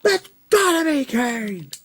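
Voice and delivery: high, slow, drawn-out hype call; shrill-pitched wrestling commentator chant; wrestling announcer call. Retold or imitated. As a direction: call his name like a ringside commentator. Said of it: "I was like".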